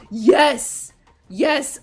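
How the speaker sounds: loud excited yes; cheering. Real, not acted. Fan cheering a favourite scene, loud joy. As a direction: punch the air with joy.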